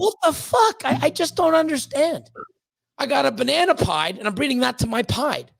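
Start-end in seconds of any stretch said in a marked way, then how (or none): 0.0-2.3 high, incredulous what the fuck; a shocked, baffled rant; stunned outrage. Real, not acted. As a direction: disbelief pitched way up. Outraged at another breeder's pairings.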